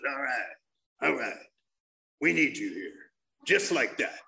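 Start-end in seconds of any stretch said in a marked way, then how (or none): 0.1-2.9 put-on low, gruff old-timer voice; old dude. Old-timer welcoming a newcomer in pajamas, low gruff voice. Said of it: "this old dude walks by me, he sees me, goes".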